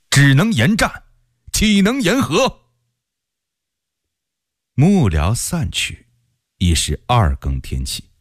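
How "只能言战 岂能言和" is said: impassioned defiance. A narrator's reading. General answering his adviser on war or peace, impassioned defiance. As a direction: voice leaps high in indignant resolve.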